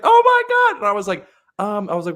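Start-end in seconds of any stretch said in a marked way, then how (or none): 0.0-0.8 shrieked, much higher, loud; mock cackling guest. Hotel guest reacting to the joke, cackling shriek. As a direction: imitate a guest cackling in shock. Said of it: "she cackled".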